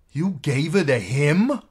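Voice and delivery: loud, high, shocked question; dismayed cry. A narrator's reading. Maid learning the girl was handed over, dismayed. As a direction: cry out in disbelief. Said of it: "she cried, dismayed".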